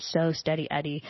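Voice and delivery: usual speaking voice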